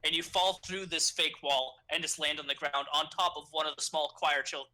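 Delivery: ordinary talk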